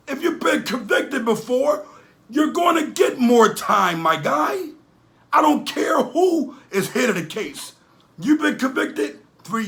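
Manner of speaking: heated scolding rant; heated lecture. Real, not acted. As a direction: lay into him, voice up high.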